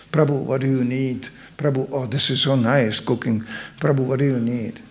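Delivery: soft, low fawning on the cooks; mock worshipful deference. Manager humouring the cooks, soft deference. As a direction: murmur it like a humble servant. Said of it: "You just make puja for the cooks".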